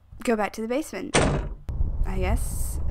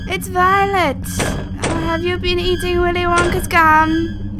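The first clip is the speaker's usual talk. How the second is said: loud, high-pitched teasing scold; mock alarmed cry; 8 semitones higher, 8 dB louder than usual. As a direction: call out to the monster in playful horror. Mock-scolding the blue monster.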